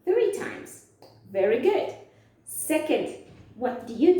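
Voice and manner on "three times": high answering voice. Shown as a demonstration. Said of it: "How many times?".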